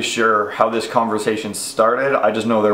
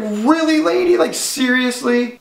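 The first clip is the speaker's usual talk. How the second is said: incredulous mock; 12 semitones higher than usual. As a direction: act shocked at the silly question. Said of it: "I was like".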